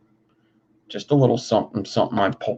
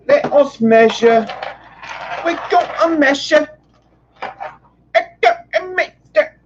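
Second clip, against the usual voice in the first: silly high voice; 4 dB louder than usual. Pretend.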